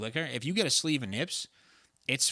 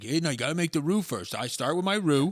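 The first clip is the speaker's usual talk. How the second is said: talking it up; 3 semitones higher than usual. Retold or imitated. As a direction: imitate a roux braggart hyping his dish. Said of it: "He was like Donald Trump".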